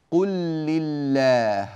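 قل لله with one doubled lam, slow and loud; slow demo of قل لله; merged double lam. Shown as a demonstration. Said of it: "اللام الأولى أدغمت في اللام الثانية".

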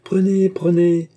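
high, loud, slow cry; urgent cry. Acted. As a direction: call out sharply, pressing them. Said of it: "s'écria la reine".

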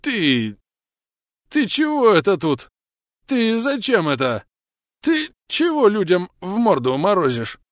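put-on high, loud slurred drunken outrage; drunk slurring indignation. A narrator's reading. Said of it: "возмущённо начало тело заплетающимся языком".

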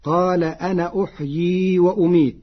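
ترتيل قرآني; Quranic recitation. Shown as a demonstration. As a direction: intone the verse as tarteel. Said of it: "يقول الله تعالى".

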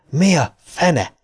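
slow, exasperated outburst; drawn-out, irritated exclamation; indignant snort. A narrator's reading. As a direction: snort it out in disbelief. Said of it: "Horkant föl Bogert".

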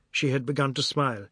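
plain reading voice

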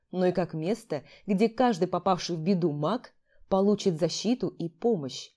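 usual speaking voice

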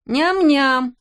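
put-on high child's shout; a toddler yelling out. A narrator's reading. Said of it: "выкрикнул мальчик".

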